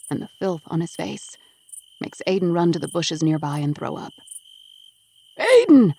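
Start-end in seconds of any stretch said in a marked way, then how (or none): 5.4-5.9 high, loud desperate yell. Acted. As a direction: scream his son's name from the cellar. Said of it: "his father yells".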